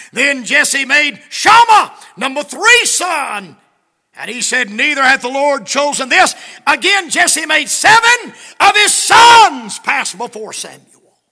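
loud, high, slow preacher's cry; preaching at full force. Acted. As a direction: shout the verse slowly to the church. Preaching the verses.

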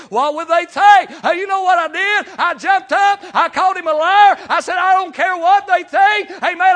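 shouting, high and loud; preaching at full force. Acted. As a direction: preach it out at the top of your voice. Preaching climax, shouting testimony.

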